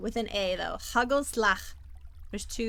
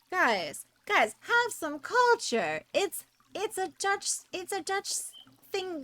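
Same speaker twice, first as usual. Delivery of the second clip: exasperated plea; 9 semitones higher than usual. Real, not acted. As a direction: plead with them, half joking. Scolding chat, exasperated.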